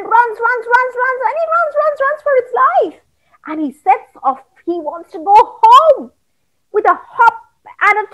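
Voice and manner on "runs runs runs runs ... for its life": building excitement. A narrator's reading. Running builds.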